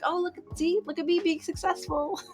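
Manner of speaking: hammed-up parent showing off their child; proud parent voice. Imagined parents bragging about daughter.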